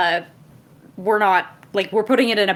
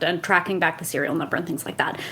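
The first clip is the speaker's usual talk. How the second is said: whispering; 4 semitones lower, 6 dB quieter than usual. Real, not acted. Trails off into whisper.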